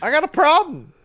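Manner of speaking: high-pitched mock complaint; mock squeal. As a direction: whine it back at him. Playing along, squeal.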